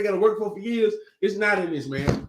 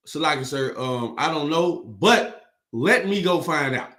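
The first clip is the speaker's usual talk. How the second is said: slow, lower, deferential answer; respectful reply; 5 semitones lower than usual. Acted. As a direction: answer a superior politely, taking your time. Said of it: "You know it's supposed to go down?".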